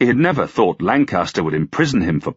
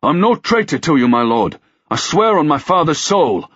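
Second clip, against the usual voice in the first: high-pitched, fervent denial; desperate protest to a lord; exclaiming; 10 semitones higher, 4 dB louder than usual. A narrator's reading. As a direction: swear your innocence urgently. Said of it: "Ruck exclaimed".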